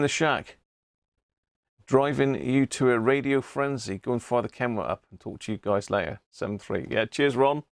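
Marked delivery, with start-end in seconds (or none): none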